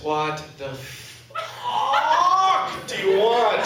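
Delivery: dragged-out, lightly hostile translation; slow mock hostility. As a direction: let the annoyance crawl out slowly. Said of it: "loosely translated".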